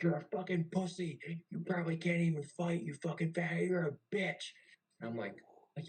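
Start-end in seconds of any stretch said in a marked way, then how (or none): 0.0-4.4 loud, high mock tirade; high-pitched retold chewing-out; screaming berating. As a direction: imitate a chief yelling abuse. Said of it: "they're screaming at the top of their lungs".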